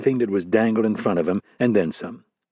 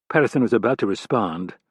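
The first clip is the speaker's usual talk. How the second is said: plain reading voice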